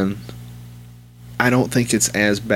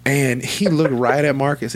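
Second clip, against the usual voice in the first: normal talking voice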